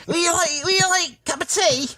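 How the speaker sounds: put-on very high, silly English tea-drinker accent. Clowning for a friend his wife's hated accent, high mock-English. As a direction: offer tea as a squeaky English caricature. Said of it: "when I do that accent".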